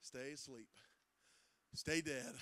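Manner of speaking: ordinary talk, nothing marked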